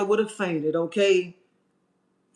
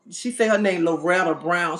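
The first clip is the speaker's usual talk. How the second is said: normal talking voice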